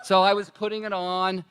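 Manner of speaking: ordinary talk, nothing marked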